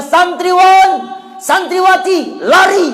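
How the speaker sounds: high, loud call to run; teacher's shout. Retold or imitated. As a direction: shout the order to flee. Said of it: "Dia minta pada santriwan". (teriakan ustadz menyuruh lari)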